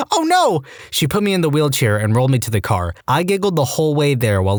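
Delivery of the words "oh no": sudden high yelp of alarm; alarmed cry. Retold or imitated. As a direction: shriek it in shock, much higher. Nurse sees patient hit wall.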